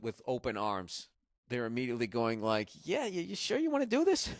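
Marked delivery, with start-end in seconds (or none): 2.8-4.3 high-pitched incredulous mock voice; doubtful local. Locals to the touring comic, high mocking doubt. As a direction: ask it way up high, as if humoring a fool. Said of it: "they were laughing".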